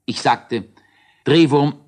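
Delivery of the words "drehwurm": calling out. Acted. Calling to Drehwurm.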